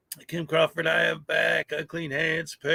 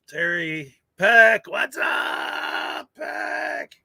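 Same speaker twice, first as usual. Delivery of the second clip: slow name rising into a high, thin holler; high-pitched hail; 9 semitones higher, much slower than usual. Real, not acted. Streamer hails a viewer by name, drawn-out high holler. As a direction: stretch it out and leap up high.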